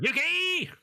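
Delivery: calling out. Retold or imitated. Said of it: "He's over here going".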